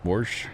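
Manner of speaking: wash said warsh with an r; Missouri warsh. Shown as a demonstration. Repeating warsh to chat. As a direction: slide an r into wash. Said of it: "Yep, wash".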